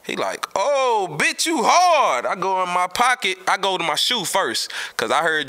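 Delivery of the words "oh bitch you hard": high, loud, drawn-out mock awe; hammed-up amazed friend; amazed exclamation. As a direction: stretch it out in amazement, much higher. Fat's amazed reaction, retold.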